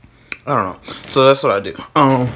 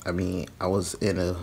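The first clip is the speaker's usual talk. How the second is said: ordinary talk, nothing marked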